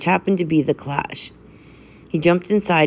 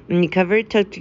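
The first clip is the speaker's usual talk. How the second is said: ordinary delivery, nothing marked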